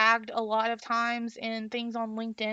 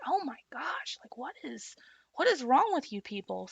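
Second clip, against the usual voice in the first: exasperated; 3 semitones higher than usual. Retold or imitated. Reacting to hostile commenters.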